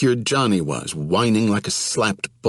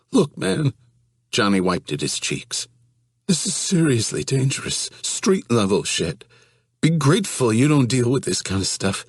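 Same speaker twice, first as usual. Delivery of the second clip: tearful pleading; 6 semitones higher than usual. Acted. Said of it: "began sobbing".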